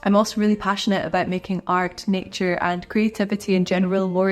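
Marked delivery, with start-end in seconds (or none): none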